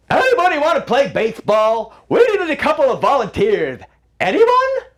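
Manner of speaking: rally the crowd to play; calling out to crowd. Acted. Hatter calling to parade crowd.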